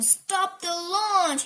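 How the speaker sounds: high, drawn-out urgent order; alarmed captain's command; yelled. A narrator's reading. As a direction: shout to halt it. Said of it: "yelled the captain".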